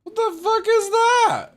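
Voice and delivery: high loud startled cry; shocked shriek. Real, not acted. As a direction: yelp in disbelief at the sight.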